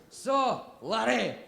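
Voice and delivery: loud, high hammed-up Scottish brogue; Scottish accent. Scottish interviewer introducing himself, in a broad Scottish accent. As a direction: boom it out like a jolly Scottish interviewer. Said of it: "the guy interviewing me is Scottish".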